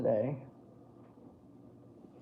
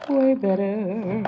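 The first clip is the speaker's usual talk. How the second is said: slow, higher and louder "Way better"; drawn-out approval; 5 semitones higher, 6 dB louder, much slower than usual. Shown as a demonstration. Admiring her finished brow, drawn-out proud approval. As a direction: stretch out "Way better" with satisfied emphasis.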